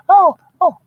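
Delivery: very high falsetto delight; high-pitched mock girlfriend gasping at the view; gushing girlfriend. Wife voiced gushing over apartment.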